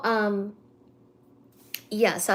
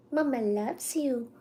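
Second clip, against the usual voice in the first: slow, much softer coo to the cat; tender coo; 2 semitones higher, 7 dB quieter than usual. Real, not acted. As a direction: murmur it lovingly to the cat. Cooing at the cat.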